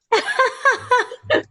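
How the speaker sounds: a sudden, high, bright laugh at yourself; high burst of laughter. Real, not acted. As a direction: laugh out loud, high and free. Laughs at her own boldness.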